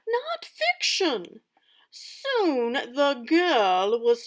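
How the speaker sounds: loud, lively storybook narration; dramatic story reading. Acted. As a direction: read it aloud like a theatrical stepmother. Teacher reads stepmother's story aloud.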